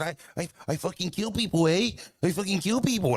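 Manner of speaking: high, punchy imitation of a drunk Canadian with an 'eh'; mock Canadian tough guy. Retelling the nephew's threat to the drunk dudes, mock Canadian menace.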